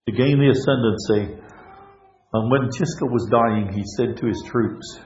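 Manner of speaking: usual speaking voice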